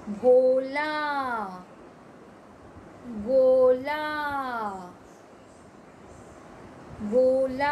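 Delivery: भोला and गोला read out loudly and clearly; loud word reading. Shown as a demonstration. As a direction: call out each word for kids to repeat. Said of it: "ओ की मात्रा लगाई".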